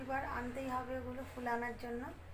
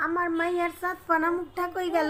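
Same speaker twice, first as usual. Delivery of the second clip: playful Bangal dialect, louder and higher; 4 semitones higher, 9 dB louder than usual. Pretend. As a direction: tease the daughter in Bangal dialect. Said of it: "আমি একটু বাঙাল ভাষায় কথা কইতাছি". (বাঙাল ভাষায় আদুরে খোঁচা)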